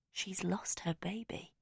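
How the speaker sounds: whispered, slow; hushed realisation. A narrator's reading. Narrator whispers her sudden realisation of the loss. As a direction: breathe it out as the truth dawns.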